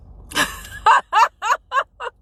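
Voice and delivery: a bright burst of laughter up high; loud amused laugh. Real, not acted. Laughing at own remark.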